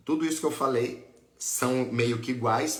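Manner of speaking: ordinary delivery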